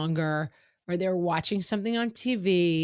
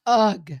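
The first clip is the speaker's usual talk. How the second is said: put-on a put-upon teenage ugh, loud; kid's loud groan; 2 semitones higher, 8 dB louder than usual. Kid groaning at mom when called to dinner, loud ugh.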